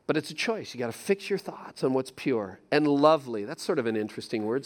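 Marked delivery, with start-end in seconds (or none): none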